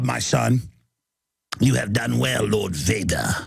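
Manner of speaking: low, sinister imitation of an evil emperor; mock villain voice, low and menacing; Emperor Palpatine. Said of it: "to quote Emperor Palpatine".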